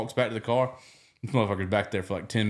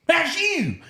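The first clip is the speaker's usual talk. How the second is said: excited recognition. Retold or imitated. Excited cop recognizing them.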